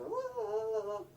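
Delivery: high, lilting la-la-la; carefree moose. Retold or imitated. Moose coming along for a drink, carefree la-la. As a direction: trill it lightly, up high then dropping. Acts out moose strolling to water.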